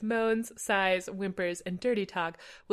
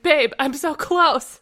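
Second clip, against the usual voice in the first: slow, louder mock passion; exclaiming; 5 semitones higher, 8 dB louder, slower than usual. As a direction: call out like a bad love scene. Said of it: "he exclaimed".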